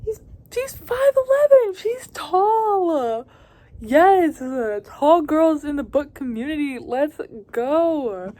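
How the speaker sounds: high, squealing fangirl joy; squealing excitement. Real, not acted. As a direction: squeal it out, overjoyed. Thrilled by the tall heroine.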